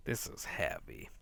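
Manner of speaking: "This is heavy" as a winded, unvoiced whisper; "This" barely voiced, breath running out; breathless whisper. Real, not acted. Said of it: "this is a heavy book".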